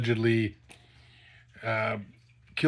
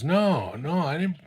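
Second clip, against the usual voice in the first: higher-pitched imitated protest; retold alarmed denial; 6 semitones higher, 6 dB louder, slower than usual. Suspect denying murder to the cop, alarmed protest. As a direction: protest innocence, as if accused.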